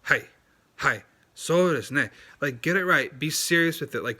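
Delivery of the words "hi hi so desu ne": Hi said as short Japanese hai; crisp native hai, so desu ne; clipped Japanese hai; natural Japanese hai. Shown as a demonstration. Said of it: "Like, no, it's".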